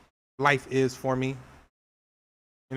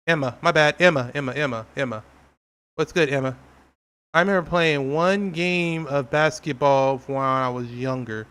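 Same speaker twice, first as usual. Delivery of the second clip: usual speaking voice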